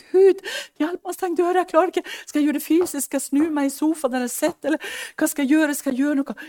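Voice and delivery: high, loud desperate pleading; crying out to God. Retold or imitated. Anie crying out to God for help, high and loud. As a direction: beg God for help at the top of your voice.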